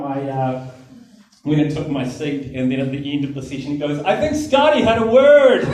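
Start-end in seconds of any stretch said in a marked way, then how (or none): 4.0-5.6 loud, high mock announcement; public announcement. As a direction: imitate a host calling out to the whole room. Speaker announces it to the room.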